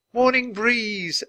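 high, slow, drawn-out happy call; cried out. A narrator's reading. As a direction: sing out the name with joy. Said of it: "Annie cried".

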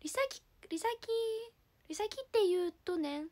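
put-on faltering retries at a Kansai accent; muddled amateur Kansai lilt; pretend Kansai dialect. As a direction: grope for the Kansai intonation on the name. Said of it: "じゃあ関西弁ね。".

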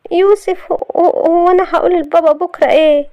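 high, halting anxious question; worried. Acted. Shahd asking what to tell her father, worried and high. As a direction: falter anxiously, pitched well up.

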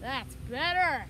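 ordinary delivery

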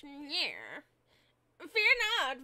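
swooping, drawn-out goofy voice; put-on character voice. Shown as a demonstration. Voice actor auditioning a character's voice, goofy and swooping. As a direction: try out a silly voice that swings up and down. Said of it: "I'll make him sound like this".

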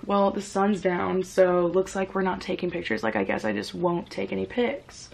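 low, soft and resigned; inner monologue. Retold or imitated. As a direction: think it to yourself, quietly giving up. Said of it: "In my head".

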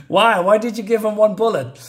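can't believe the choice; baffled outburst. Real, not acted.

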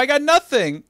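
high, loud, hammed-up plea; exasperated protest. Host defending his new favourite wrestler, exasperated protest. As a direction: protest as if it were a tragedy.

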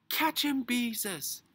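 high, loud call for help; urgent shout. A narrator's reading. Henry to Beezus as the dog runs off, urgent shout. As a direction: yell it out urgently.

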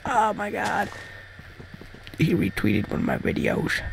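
starstruck, under the breath; hushed awe. Retold or imitated. Retelling an awed reaction to a retweet.

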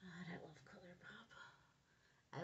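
slow whispered aside. Real, not acted. A slow whisper of love for her favourite brand. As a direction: breathe the line out slowly, almost to herself.

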